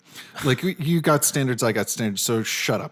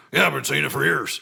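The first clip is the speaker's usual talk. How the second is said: retold conspiracy host; 3 semitones higher than usual. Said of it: "He's like".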